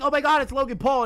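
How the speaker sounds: high, fast fan squeal; mock excited fan; starstruck fan. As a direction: gush like a fan spotting a celebrity. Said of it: "and people are like".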